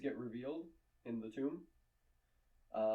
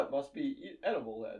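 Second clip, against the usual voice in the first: ordinary delivery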